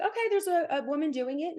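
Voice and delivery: put-on light up like a kid who just found her dream; excited realization. Young girl hearing her dad, excitedly realizing. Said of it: "So to me, I was like".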